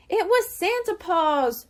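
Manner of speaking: high, loud and slow; excited cry. A narrator's reading. Shrimp tells his family about Santa Paws, high and loud. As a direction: shout the happy news. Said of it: "Shrimp cried".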